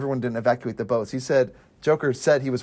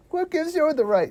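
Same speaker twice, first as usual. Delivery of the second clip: chubby little Batman. Acted. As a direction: imitate an affronted amateur vigilante, pitched way up. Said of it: "It's like a chubby little Batman".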